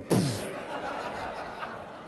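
long breathy burst of laughter; real laughter. Real, not acted. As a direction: laugh at the memory. Laughing at felled tree.

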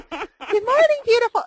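high mock Kathy greeting; lilting high caricature; imitating Kathy. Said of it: "It was closer".

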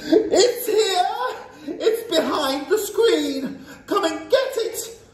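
high, gleeful witch's cry; triumphant yell. A narrator's reading. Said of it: "the face opened its mouth and yelled triumphantly".

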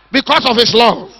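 ordinary talk, nothing marked

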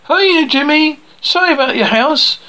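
high, sing-out mock greeting; put-on high-pitched taunt; taunting call. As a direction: call it out high with a fake apology. Character taunts the boy's enemy.

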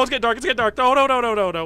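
high, loud alarm; rapid-fire frightened blurting; panicked. Real, not acted. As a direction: cry out as the dark closes in. Lights go out in game.

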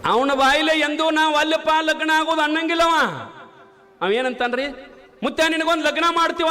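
high, ringing sermon voice; rising pulpit proclamation; fiery preaching. Acted. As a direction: preach it out to the crowd. Preacher raises voice.

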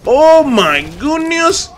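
high, loud, incredulous outburst; outraged yelling. Real, not acted. Gamer raging at a spamming Scorpion opponent, high outraged yells. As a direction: yell in disbelief, voice shooting way up.

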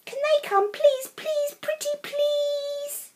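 high, pleading cub voices, last please drawn out slow; pretty please stretched long; begging cubs. A narrator's reading. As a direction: beg like little cubs.

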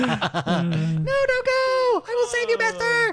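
squeaky high anime sidekick, laughing; hammy cartoon hero squeak; overacted anime dub.

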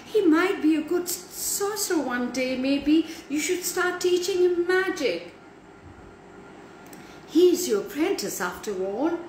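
high-pitched, eager helper's voice; Tabitha. A narrator's reading. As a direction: plead the case in a high voice. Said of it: "Tabitha spoke".

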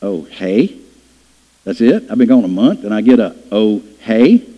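low, incredulous "Oh, hey?" thrown back; indignant echo. Retold or imitated. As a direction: repeat "Oh, hey?" in disbelief after a month away. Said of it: "And you're like".

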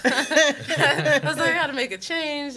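excited laugh retelling the move. Real, not acted. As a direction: giggle it out while recalling the decision. Laughing while telling leap.

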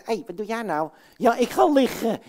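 usual speaking voice

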